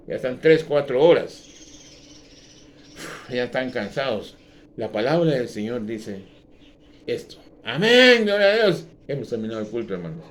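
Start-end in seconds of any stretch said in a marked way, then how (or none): 0.1-8.9 loud, fervent pulpit delivery; fiery sermon voice; preaching at full force. Acted. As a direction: thunder it out to the congregation.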